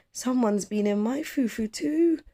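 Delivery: a startled mother crying out; exclaiming mama. Acted. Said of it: "she exclaimed".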